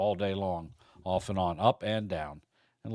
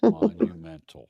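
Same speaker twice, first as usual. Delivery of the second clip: said slowly; 8 semitones higher, 10 dB louder than usual. Shown as a demonstration. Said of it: "I'll say it slow".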